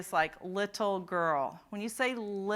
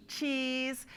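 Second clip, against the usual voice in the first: say cheese; 5 semitones higher than usual. Shown as a demonstration. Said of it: "say what?".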